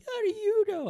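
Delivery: high-pitched, tearful protest; wailed question, imitating an upset child; mock crying kid. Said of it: "like, he's crying".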